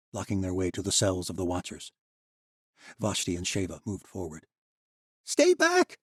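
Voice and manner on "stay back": very high, loud shriek that cracks; a desperate boy screaming, voice breaking; panicked shriek with a cracked voice; cracking shriek. A narrator's reading. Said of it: "the boy shrieked, his voice cracking".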